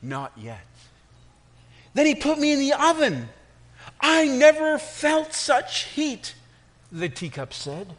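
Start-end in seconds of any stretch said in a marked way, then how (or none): 0.0-0.7 low, soft, unhurried; calm master. Acted. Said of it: "nodded and said". 1.9-6.3 teacup in the oven. Acted. Cup tells of oven.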